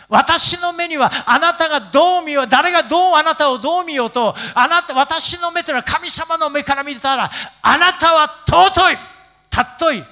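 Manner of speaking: high, loud proclamation; forceful preaching. Acted. Preacher proclaims God's regard to congregation, forceful preaching. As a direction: preach it at full force, stretching the key words.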